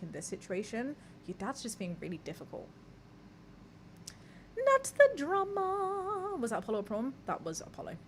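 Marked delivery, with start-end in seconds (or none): 4.6-6.4 high, slow sing-song not the drama; high drawn-out catchphrase. Acted. Streamer to chat, sing-song high not the drama.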